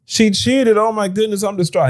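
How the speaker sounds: high, whiny mock sob; mock crying man. A man crying on a live stream, mock high sobbing voice. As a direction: ham up a heartbroken guy's tears. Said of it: "and he's crying".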